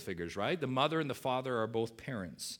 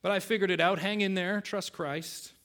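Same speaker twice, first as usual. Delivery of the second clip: raised, louder mock-noble advice; preachy superiority; 6 semitones higher, 3 dB louder than usual. Preacher mocking smug advice to younger men. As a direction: lecture a younger brother from above. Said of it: "Sounds very noble".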